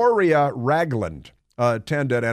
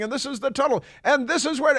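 put-on high excited yell in a French accent; 13 semitones higher than usual. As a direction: shout it like a thrilled tour driver. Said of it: "he's yelling".

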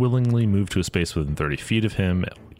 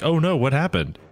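panicked; 8 semitones higher than usual. Acted. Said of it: "like a panicked point of".

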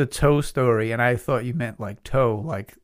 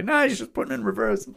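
much higher dismissive dad voice; imitated dad; 11 semitones higher than usual. Dad to some guy about the truck, dismissive. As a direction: brush it off in a raised pitch. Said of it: "My dad's telling some guy".

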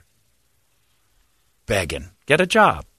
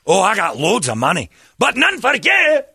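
put-on Scottish accent punchline; 11 semitones higher, 4 dB louder than usual. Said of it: "if you say it with a Scottish accent".

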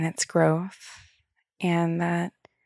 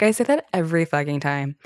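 ordinary talk, nothing marked

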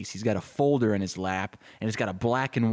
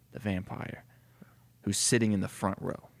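ordinary delivery

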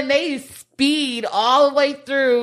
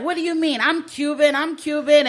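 defensive insistence; 7 semitones higher than usual. Retold or imitated. Character insisting he is Cuban.

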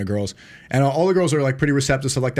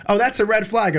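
higher-pitched, lightly mocking girl voice; imitating the girls; 7 semitones higher than usual. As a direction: mimic a disapproving girl, pitched up. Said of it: "and then they're like".

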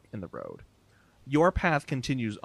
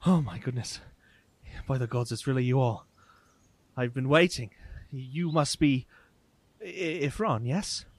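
lightly acted awe, rising and halting; a touch of breathless recognition, stumbling on the name; excited stranger; 2 semitones higher than usual. Haggard man greeting long-awaited travellers, mildly excited. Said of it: "just pure excitement".

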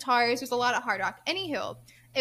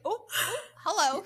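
high, sing-song fake-polite greeting; imitating her own startled hello; mock surprise; 7 semitones higher than usual. Mocking surprise at odd strangers.

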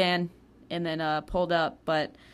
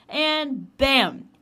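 loud, high burst on bam; sudden bam; 8 semitones higher, 9 dB louder than usual. Retold or imitated. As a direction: slam the word out like an impact. Impact as she hits the edge.

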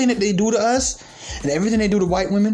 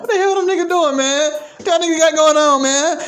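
high, louder disbelief; incredulous; 9 semitones higher, 4 dB louder than usual. Real, not acted. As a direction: ask it in total disbelief. Incredulous loud questioning.

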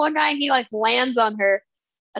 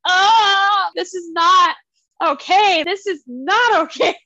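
high, loud, half-crying whine of horror; mock horrified wail; 6 semitones higher, 5 dB louder, slower than usual. Telling her co-host how a skin mask made her feel, a loud mock-horrified wail. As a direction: ham up being horrified, voice cracking like a near sob.

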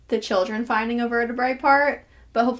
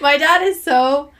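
higher, louder, slowed exasperation; exasperated; 4 semitones higher, 7 dB louder, slower than usual. Real, not acted. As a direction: drag it out in exasperation. Exasperated about dad.

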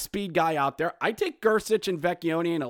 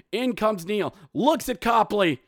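normal talking voice

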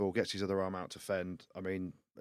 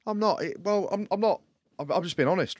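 defensive; 8 semitones higher, 5 dB louder than usual. Real, not acted. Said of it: "Why being horrible?".

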